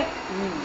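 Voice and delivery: soft low "Hmm" of a listener; listening hum. Shown as a demonstration. Teacher shows children a listener's soft hum. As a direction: hum softly to show you are listening. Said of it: "you should say".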